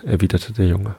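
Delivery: ordinary delivery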